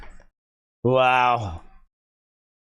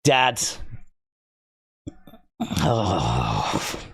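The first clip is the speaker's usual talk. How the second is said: choked up; 13 semitones lower, 5 dB quieter than usual. Real, not acted. Emotional, choked up.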